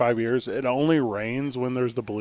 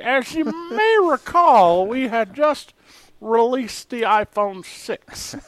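very high, slow newsreel voice; old newsreel narrator; 10 semitones higher, 5 dB louder, much slower than usual. Pretend. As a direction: boom it out like vintage film. Continues the old-timey bit.

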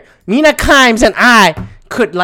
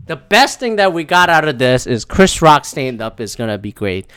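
ordinary talk, nothing marked

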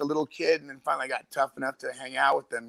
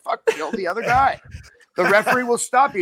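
coach's order; 9 semitones higher, 4 dB louder than usual. Retold or imitated. Quoting coach's instruction.

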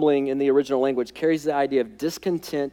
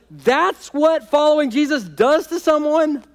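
dismissive outsider; 7 semitones higher, 3 dB louder than usual. Retold or imitated. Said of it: "the world looks at that and goes".

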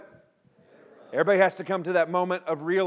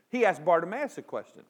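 ordinary talk

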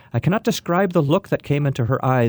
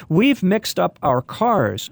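said slowly and deliberately, a bit higher; slow, clear repeat; 6 semitones higher, much slower than usual. A narrator's reading. Dad repeating slowly to the confused old woman, slow. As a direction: spell it out slowly for her. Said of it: "expressed himself again".

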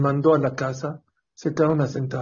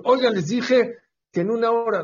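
raised, higher-pitched reproach; annoyed rebuke; 7 semitones higher than usual. Acted. Rabbi to the couple back too soon, annoyed rebuke. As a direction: scold them for turning up early.

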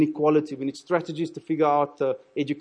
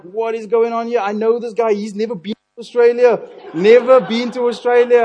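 high, loud mock disbelief; incredulous; 7 semitones higher, 9 dB louder than usual. Preacher recalling his baffled reaction to the worship leader, incredulous. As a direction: exclaim in comic, baffled protest, voice pitched up.

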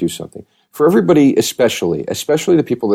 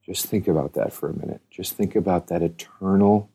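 guided meditation; 2 semitones lower, 4 dB quieter than usual. Acted. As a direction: lead them gently into the dark. Leads a grim death meditation.

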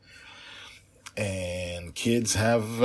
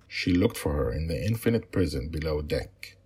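steady storytelling voice for a bedtime tale; story narration; 5 dB louder than usual. A narrator's reading. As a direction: read it like a father telling his girls a story. Father reads his story aloud.

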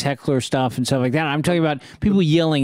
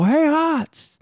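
high, drawn-out holler; retold yelling; much slower than usual. Comic on stage hollering at Hotz in the wings, yelling. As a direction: call out at the top of your pitch, reenacting it. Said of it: "I'm just yelling".

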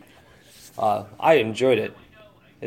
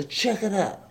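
"check it out" said high in a silly voice; high put-on voice; 9 semitones higher than usual. Shown as a demonstration. Host recommending the film, silly high repeat. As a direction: repeat it as a goofy character.

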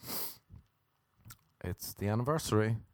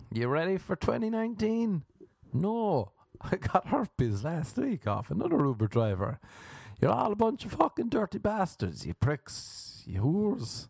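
mock rant; 11 semitones higher than usual. Comic berating a bunch of dirty bastards, high mock rant. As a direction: throw out insults in a high voice.

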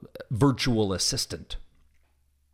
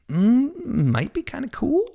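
much higher, louder, put-on impressed voice; mock impressed; 3 dB louder, slower than usual. Officer imagining the tech, mock impressed and much higher. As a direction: ham up being tempted by the gadget. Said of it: "that's where I go".

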